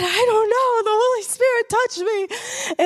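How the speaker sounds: high-pitched, overwhelmed reply; retold tearful outburst. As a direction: re-enact being overcome, pitch lifted throughout. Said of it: "And I'm crying, and I'm shaking".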